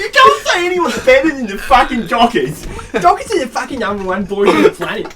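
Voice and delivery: loud, hammed-up Aussie footy fan; thick Australian accent. Mate boasting about the Dockers to the boys, thick Australian accent. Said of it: "is that your thickest Australian accent".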